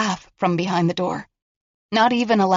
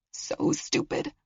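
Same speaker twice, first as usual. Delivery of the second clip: slower, higher whispered self-blame; 9 semitones higher, 9 dB quieter, slower than usual. Acted. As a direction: drag it out under breath.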